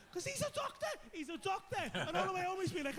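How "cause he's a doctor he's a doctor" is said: high-pitched incredulous cry; squeaky, indignant insistence; exasperated outburst. Real, not acted. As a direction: blurt it out, voice shooting up. Outraged aside about the friend.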